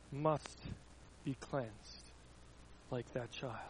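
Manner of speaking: normal talking voice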